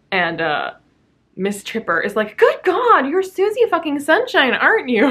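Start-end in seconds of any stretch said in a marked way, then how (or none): 2.4-5.0 mock exasperation. Voicing exasperated character.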